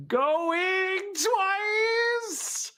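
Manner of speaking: high, long drawn-out call; auctioneer's call. Pretend.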